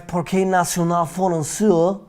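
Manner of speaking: put-on French accent. Shown as a demonstration. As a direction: say it in your best French accent. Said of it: "it's like my French accent".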